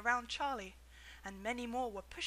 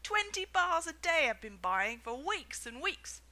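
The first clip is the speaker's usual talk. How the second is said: angry shout; 5 semitones higher, 7 dB louder, slower than usual. A narrator's reading. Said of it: "a large boy shouted angrily".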